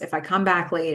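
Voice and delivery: ordinary talk, nothing marked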